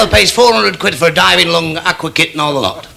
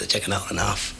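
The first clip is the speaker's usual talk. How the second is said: much lower, hushed admission; meek aside; 8 semitones lower, 14 dB quieter than usual. Acted. Heath confesses the long dinner, meek hushed aside. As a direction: drop it to a low, sheepish murmur.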